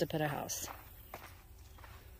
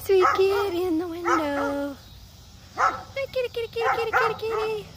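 much higher sweet kitty call; cooing to a cat; 12 semitones higher, 6 dB louder than usual. Real, not acted. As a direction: coo up high to lure the cat. Calling to cat in window.

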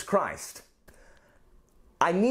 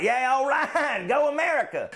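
cheering Americans; 7 semitones higher than usual. Acted. Americans cheering their trade deal, hammed-up patriotic whoop. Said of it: "And the Americans are like".

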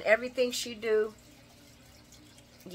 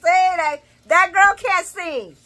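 put-on louder, higher, slower: that GIRL can't sing; saying it out loud; 8 semitones higher, 9 dB louder, slower than usual. Shown as a demonstration. Vlogger repeating her verdict on Blaze, loud and slow. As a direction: belt the verdict out on purpose. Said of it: "I said it".

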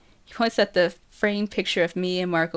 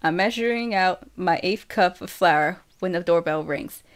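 ordinary delivery, nothing marked

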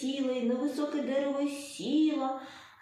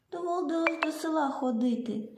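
plain reading voice

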